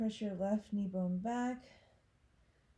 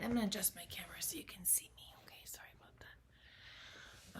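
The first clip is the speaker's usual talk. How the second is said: whispered aside; 2 semitones lower, 10 dB quieter than usual. Real, not acted. Quick aside while adjusting camera.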